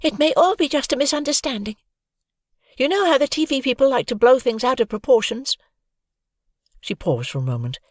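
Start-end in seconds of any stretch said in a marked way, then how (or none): 0.0-5.6 put-on much higher girl's voice, steadying; calm a panicking friend; calm, confident. A narrator's reading. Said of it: "calm and as confident as possible".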